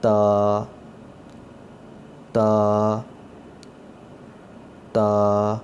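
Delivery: تا said clearly and drawn out; Arabic ta. Shown as a demonstration. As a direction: model the letter تا. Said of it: "عربی زبان کے پڑھتے ہوئے". (تا کی عربی ادائیگی)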